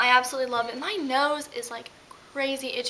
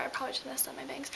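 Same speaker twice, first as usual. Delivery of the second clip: quick breathy whisper to herself; whispered aside; 16 dB quieter, faster than usual. Real, not acted. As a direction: whisper it under your breath, fast. Aside while fixing her hair.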